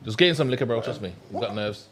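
ordinary talk, nothing marked

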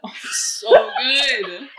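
slow, loud laughing boast; mock brag through laughter; laughing sarcasm. Real, not acted. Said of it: "I'm so good at that".

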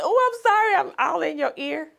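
high, loud burst of apology; overexcited apology. Retold or imitated. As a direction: blurt it out high and loud after a scream. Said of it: "I just screamed out".

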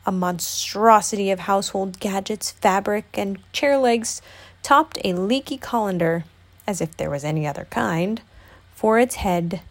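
ordinary delivery, nothing marked

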